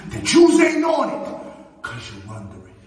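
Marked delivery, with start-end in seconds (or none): 0.1-1.8 loud, high threat; yelling. Acted. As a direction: bark the threat out. Promo threat yelled.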